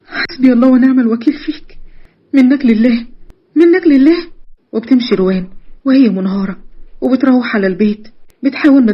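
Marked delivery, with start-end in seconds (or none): 0.1-4.3 anguished curse. Acted. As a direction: build in pitch as you curse him. Victim curses him, breaking down.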